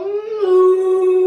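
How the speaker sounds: long, loud moo on one breath; sustained cow call. Pretend. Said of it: "blow out and go".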